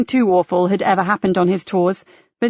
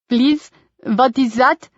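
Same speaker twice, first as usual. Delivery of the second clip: put-on slow, higher German-accented tourist; 3 semitones higher, slower than usual. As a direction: ask it slowly in a German accent. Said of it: "with a heavy Germanic accent".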